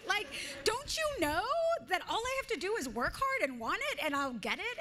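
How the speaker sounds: high, loud incredulous outburst; indignant young self. Retold or imitated. Actress voicing her younger self scolding doubtful family. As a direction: burst out with naive certainty. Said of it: "all I would think is".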